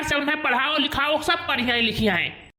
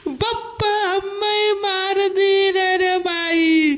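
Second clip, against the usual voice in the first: slow, sing-song wail, higher and louder; hammed-up wailing; 4 semitones higher, 3 dB louder, much slower than usual. Beaten kid wails to his father, slow sing-song wailing. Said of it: "यक्का आवाज निकाल रहे हो ना".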